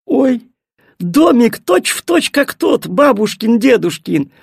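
high, drawn-out cry of amazement; a little girl amazed at what she sees; gasping surprise. A narrator's reading. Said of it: "ахнула Миттель". (изумлённо ахая)

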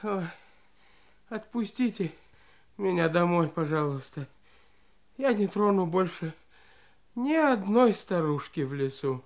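low, frightened begging; pleading. Acted. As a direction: beg to be let go. Warden begs to go home. (жалобно умоляя)

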